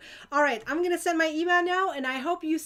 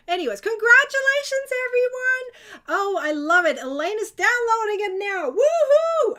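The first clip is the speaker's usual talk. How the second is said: much louder and higher, bursting with joy; excited cheer; 9 semitones higher, 9 dB louder than usual. Real, not acted. Host cheering viewers who just bought the ebook, thrilled. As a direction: shout congratulations like celebrating a win.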